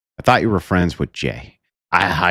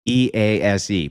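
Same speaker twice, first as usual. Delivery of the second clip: ordinary talk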